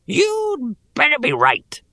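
high, slow rasping goblin threat. Acted. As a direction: rasp it like a menacing goblin. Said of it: "rasped the goblin".

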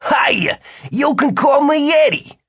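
put-on thick New Jersey accent, high; casual New Jersey guy voice. A narrator's reading. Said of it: "in a thick New Jersey accent".

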